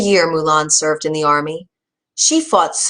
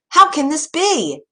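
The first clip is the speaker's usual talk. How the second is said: much higher, outraged cry; yelled; 10 semitones higher than usual. A narrator's reading. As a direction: throw the question up high in disbelief. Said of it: "yelled the soldiers".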